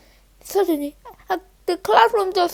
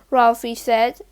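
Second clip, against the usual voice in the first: ordinary delivery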